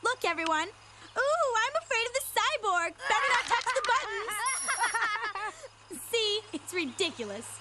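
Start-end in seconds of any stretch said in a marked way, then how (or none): 0.7-4.3 mock fear. [name] mocking fear of cyborg.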